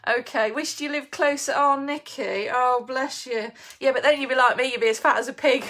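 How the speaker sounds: ordinary talk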